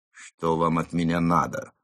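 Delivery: plain reading voice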